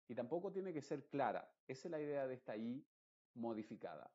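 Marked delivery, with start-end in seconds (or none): none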